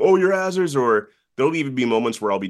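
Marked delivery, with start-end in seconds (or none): 0.0-0.8 mock recognition. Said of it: "someone going".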